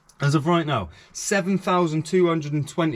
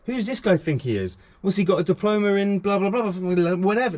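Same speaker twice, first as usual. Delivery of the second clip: imitating a scornful doubter, pitched higher; mock sceptic; 5 semitones higher than usual. Viewer scoffing at his right to advise, mock scornful. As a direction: rattle off the doubter's dismissive challenge.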